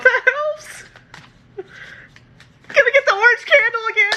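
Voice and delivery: high put-on voice. High put-on voice after reading.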